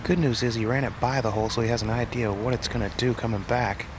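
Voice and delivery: ordinary talk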